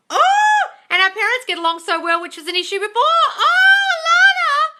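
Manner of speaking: very high, loud gushing excitement; thrilled high-pitched cheering; excited squeals. Real, not acted. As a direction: squeal with joy at the news. Viewer announces getaway with SP.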